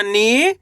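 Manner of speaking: "नी" stretched with a rise; long ee. Shown as a demonstration. Teacher shows the method, long rising "नी". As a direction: hold the ee in "नी" and lift it. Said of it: "खींच के पढ़ा जाता है".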